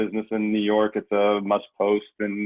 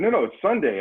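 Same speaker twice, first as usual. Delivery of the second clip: cashier's refusal, pitched high and louder; firm cashier; 10 semitones higher, 6 dB louder than usual. Retold or imitated. Cashier telling the student no beer on Sunday, firm. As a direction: firmly turn the customer down.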